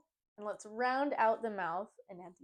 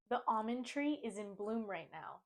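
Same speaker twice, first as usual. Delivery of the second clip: normal talking voice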